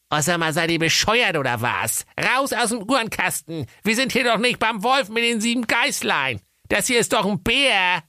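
high exasperated mother voice; high mum telling kids off; scolding mother. A narrator's reading. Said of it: "Die Mutter Keule sprach".

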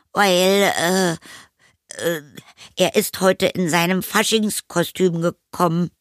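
put-on high, halting boy's excuse; flustered boy. A narrator's reading. Anton excuses hidden friend to mother, halting. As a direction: hesitate high between words, hunting for an answer.

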